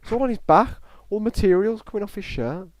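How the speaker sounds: high, loud, incredulous exclamations; real astonishment. Real, not acted. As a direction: cry out high and loud in disbelief. Astonished at shirt material flaking off.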